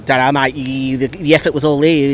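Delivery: lightly put-on, rough Australian accent; awful Aussie accent. Fan imitating the struggling Forest manager, awful Aussie accent. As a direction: do a bad Aussie manager impression. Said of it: "That's my awful, awful Aussie accent".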